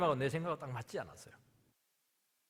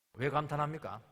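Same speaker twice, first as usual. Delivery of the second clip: ordinary talk, nothing marked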